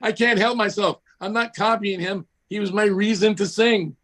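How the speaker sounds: pleading, pitched well up; mock defensive protest. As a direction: defend yourself, a bit wound up. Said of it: "And I went".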